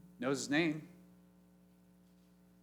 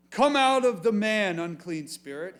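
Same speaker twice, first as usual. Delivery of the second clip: commanding; 4 semitones higher, 8 dB louder than usual. Acted. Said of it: "Jesus had said to him".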